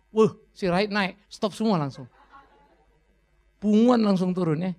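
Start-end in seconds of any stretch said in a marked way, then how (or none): none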